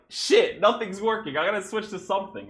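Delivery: frustrated outburst. Retold or imitated. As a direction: blurt it out as if the game is failing you. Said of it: "Because I'm like".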